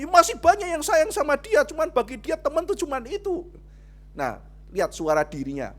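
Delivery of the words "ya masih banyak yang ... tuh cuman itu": put-on high girl voice. Friend's voice retold.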